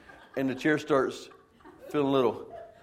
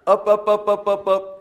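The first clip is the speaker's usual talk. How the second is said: much higher and louder, chanting each up; rising up; 9 semitones higher, 11 dB louder than usual. Retold or imitated. Preacher rising on the lift to the ceiling, high and loud. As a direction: ride the climb up with mounting alarm.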